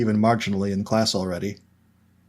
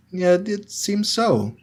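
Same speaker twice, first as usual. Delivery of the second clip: Hermione's voice; 10 semitones higher, much slower than usual. A narrator's reading. Hermione answers.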